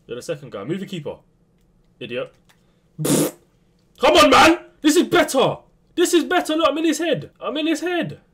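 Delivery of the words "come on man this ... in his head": high, loud, triumphant taunting; gloating shout. Real, not acted. Gamer gloating that he is in his opponent's head, loud triumphant taunt. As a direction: crow over the opponent.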